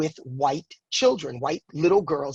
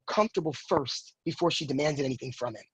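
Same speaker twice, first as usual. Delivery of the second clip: usual speaking voice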